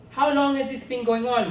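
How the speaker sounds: father confronting his wife in their bedroom; angry demand. A narrator's reading. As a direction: confront her over it. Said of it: "my father demanded".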